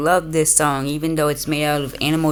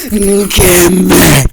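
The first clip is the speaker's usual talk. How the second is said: very loud, drawn-out mock yell; shouted demand; 2 semitones higher, 12 dB louder than usual. Playing a creature after your food, shouted demand. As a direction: yell it like a greedy game monster.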